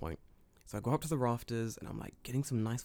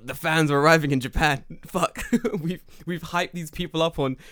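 mock panic; 4 semitones higher, 7 dB louder than usual. Retold panicked message to cohost.

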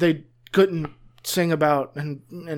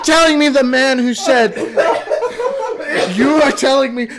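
loud, high incredulous laugh; can't believe what you're hearing; stunned laughing outburst; dumbstruck disbelief; 8 semitones higher, 6 dB louder than usual. Real, not acted. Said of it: "I'm so dumbstruck".

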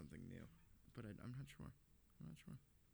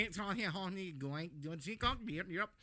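garbled mock alien speech, slowed; alien dog voice; 4 semitones higher than usual. Imagining a dog speaking an alien language, garbled gibberish. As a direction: babble like an alien talking dog. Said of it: "trying to do Alien".

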